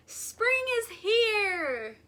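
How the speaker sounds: high, loud, slow joyful shout. A narrator's reading. Groundhog shouting the news through the woods. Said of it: "he shouted".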